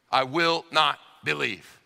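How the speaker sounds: "I will not believe" slowly, word by word; emphatic refusal. Acted. Preacher restating Thomas's refusal, emphatic. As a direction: set WILL and NOT apart with deliberate weight. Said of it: "it's emphatic".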